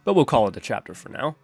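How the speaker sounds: usual speaking voice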